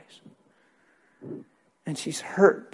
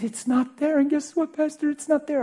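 much higher and louder, bursting imitation; joyful news; 12 semitones higher, 4 dB louder than usual. Healed woman telling her pastor, thrilled. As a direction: shout the miracle like a delighted woman.

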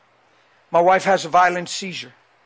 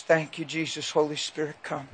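much softer, hushed plea to the Spirit; whispered prayer; 3 semitones lower, 10 dB quieter than usual. Retold or imitated. Husband at wife's hospital bed, whispered prayer. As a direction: breathe the prayer under the voice. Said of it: "I'm just standing there like this".

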